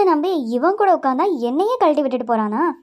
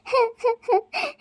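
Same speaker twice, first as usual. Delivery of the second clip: high whimpering sob; 9 semitones higher than usual. Acted. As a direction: let out a small hurt sob. Everyone laughs at her.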